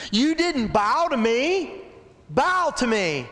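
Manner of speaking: high, slow, demanding voice of a proud official; furious Haman. Acted. Haman demands Mordecai bow, high and slow. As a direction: drag out the order to bow.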